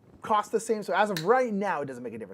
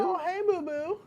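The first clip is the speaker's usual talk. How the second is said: high voice; 12 semitones higher than usual. Pretend. Playful high greeting.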